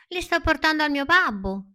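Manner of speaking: high, loud proud little puppet. Acted. Pinocchio telling the fox about his father, proudly. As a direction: say it with puffed-up pride. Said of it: "rispose Pinocchio con orgoglio".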